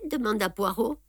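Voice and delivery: usual speaking voice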